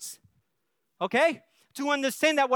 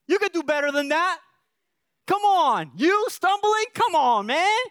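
loud, higher mocking scold; taunting father; 5 semitones higher, 3 dB louder than usual. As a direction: needle the kid, louder and higher. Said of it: "I didn't say".

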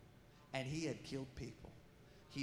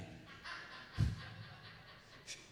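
laugh. Real, not acted. Preacher laughs at the grunt.

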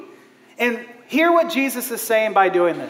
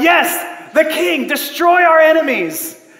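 higher, louder rallying cry; triumphant cheer; 6 semitones higher, 5 dB louder, slower than usual. Retold or imitated. Crowd hailing a warrior king, triumphant cheering. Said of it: "And we're like".

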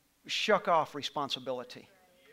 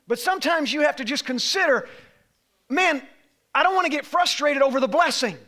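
loud, higher, rising sermon; full-force preaching; 7 semitones higher, 9 dB louder than usual. Acted. Pastor urging his church, preaching at full force. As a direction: drive it to a climax.

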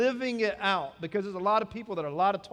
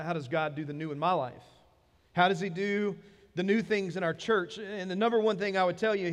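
ordinary delivery

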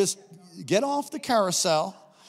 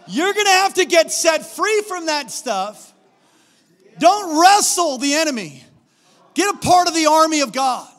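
high, loud exhortation from the pulpit; full-force preaching; 10 semitones higher, 10 dB louder than usual. Real, not acted. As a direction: preach it hard, urging the congregation.

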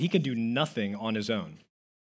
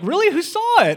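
much higher, loud mock disbelief; doubting buddies; 10 dB louder than usual. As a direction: play the sceptical friend, hammed up. Buddies doubt the hole in one.

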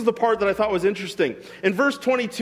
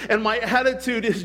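usual speaking voice

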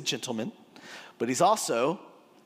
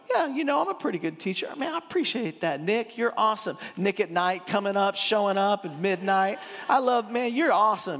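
high-pitched, hammed-up chummy thanks; mock flattered gushing; 6 semitones higher than usual. Preacher acting how Jesus did not answer Nick, mock gushing. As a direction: gush like someone lapping up praise.

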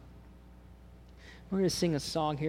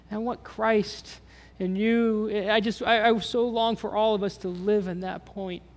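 higher, louder, earnest plea; passionate longing; 6 semitones higher, 4 dB louder than usual. Real, not acted. Preacher pleading with his congregation to live free, high and earnest. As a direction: plead with the room from the heart.

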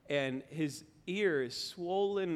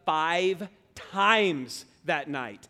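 FIVE and TIMES each punched loud and drawn out; emphatic stress; 6 dB louder, much slower than usual. Real, not acted. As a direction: slowly hammer FIVE, then TIMES. Emphatic count of wake-ups.

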